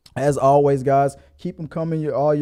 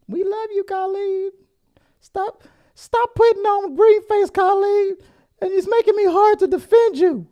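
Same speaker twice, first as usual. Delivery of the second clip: mock pleading; 4 dB louder than usual. Pleading to Colleen.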